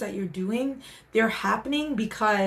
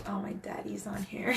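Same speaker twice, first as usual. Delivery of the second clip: a soft unvoiced whisper to herself; whispered aside; 9 dB quieter than usual. Real, not acted. Livestream host whispering on seeing her dad join the chat. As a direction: whisper it like a private little secret.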